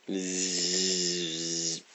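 very soft, steady insect buzz; mosquito buzz. Acted. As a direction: drone quietly like a mosquito. Mosquito buzzing before a swat.

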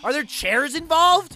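ordinary delivery

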